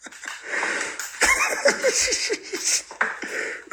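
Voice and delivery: long breathless high wheeze of laughter. Real, not acted. Streamer cracking up at a viewer's name, wheezing. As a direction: lose it laughing.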